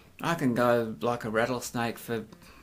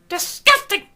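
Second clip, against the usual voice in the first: even higher, loud shrieked "disgusting."; mock outrage; 9 dB louder than usual. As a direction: top the first take, shriller prude.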